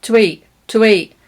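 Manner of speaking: "to eat" with t and schwa, "tə eat"; quick light "tə" before "eat"; weak form. Shown as a demonstration. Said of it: "I'm using the t and the schwa".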